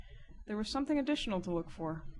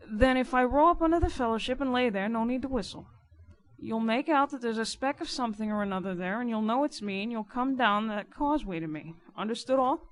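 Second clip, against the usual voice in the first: louder, insistent riverman; emphatic character voice, ending on a raised question; informer giving orders; 4 semitones higher, 6 dB louder than usual. Acted. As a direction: lay down the plan firmly. Narrator voices Riderhood instructing.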